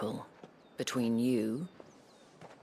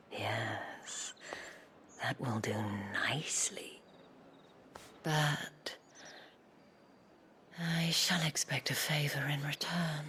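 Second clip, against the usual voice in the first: breathy, teasing invitation; seductive whisper; 3 semitones higher than usual. Acted. Isabel teasing Clive, breathy and seductive. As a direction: draw him in with a hush.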